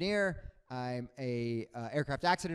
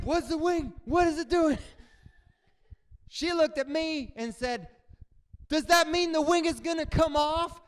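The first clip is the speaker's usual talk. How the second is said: panicked passengers; 11 semitones higher, 5 dB louder than usual. Retold or imitated.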